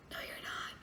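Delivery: whispered no you're not; soft whispered rebuttal; whispered put-down. Retold or imitated. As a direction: whisper it flatly to her. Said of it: "I'm like".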